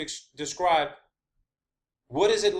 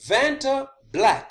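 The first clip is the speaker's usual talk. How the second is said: VANTABLACK slow, loud and high; emphatic repeat; 7 semitones higher, 6 dB louder, much slower than usual. Real, not acted. Presenter announcing the substance's name to viewers, slow and emphatic. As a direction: hit VANTABLACK hard, drawn out word by word.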